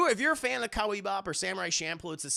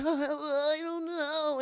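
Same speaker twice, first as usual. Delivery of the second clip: high, slow whimpering I don't know; mock crying; 10 semitones higher, much slower than usual. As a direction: blubber it like a child. Mom's crying answer retold.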